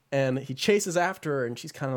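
usual speaking voice

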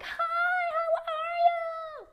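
squealed high and loud, drawn out; gushing American hello. Retold or imitated. Overexcited American greeting a friend, squealing. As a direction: gush like an over-eager American friend. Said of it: "they will not be like".

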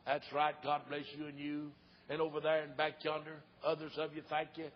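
low, soft invitation to pray; altar call. Real, not acted.

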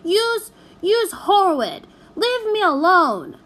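high, loud bratty screaming; girl shrieking in a tantrum; tantrum yelling. A narrator's reading. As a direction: scream it like a spoilt child.

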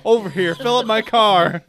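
mock Southern calling-out; Southern accent. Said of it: "go back to the southern accent".

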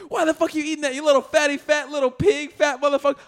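high-pitched mock tirade; imitated angry scolding. Retelling a mother catching her kid eating candy, mock angry scolding. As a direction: scold like an outraged movie mom, hammed up. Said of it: "she says it just like that".

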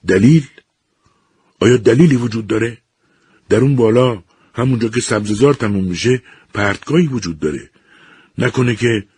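high, loud, frantic outburst; husband panicking that his wife fell from the cliff; panic. A narrator's reading. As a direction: voice straining with growing fear. Panicked husband fears cliff fall.

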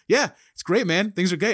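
high, loud, gushing; excited Labrador voice; excitable imitation. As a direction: spill it out like an excited puppy. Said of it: "it's like".

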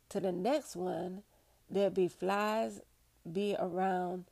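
put-on unhurried old-time Southern dialect storyteller; measured first-person recollection in character; Harriet Tubman. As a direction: recount it slowly in dialect, pausing between phrases. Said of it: "as related by herself".

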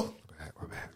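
sheepish whisper. Real, not acted. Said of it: "sheepish apology".

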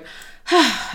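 loud, exasperated mock sigh; a hammed-up sigh of offence; huffy sigh. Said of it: "storms off in a huff".

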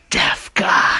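loud, forceful whisper; stage whisper. Retold or imitated. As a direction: hiss it as a secret cue. Evil coworker cues the prank again.

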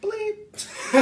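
high-pitched spoken bleep; mock censor bleep. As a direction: imitate a censor beep with your voice.

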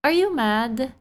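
lightly voiced small child asking; a hint of a toddler's curious question; little daughter. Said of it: "she goes".

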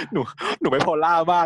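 lightly put-on baby-cute voice, laughing; cute girly voice. Recapping Can's line in a cute voice. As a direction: play it sweet and babyish, a touch hammed. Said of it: "ก็ทำแบบเสียงแบ๊วขึ้นมาอีกแล้ว".

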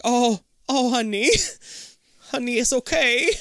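high, tender retold comforting; cooing sympathy. Viewer reacts to the heartbroken mother, cooing sympathy. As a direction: coo it as if soothing someone hurting.

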